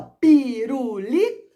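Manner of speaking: "Pirulito" drawn out slowly, rising at the end; syllable by syllable. Shown as a demonstration. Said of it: "ó".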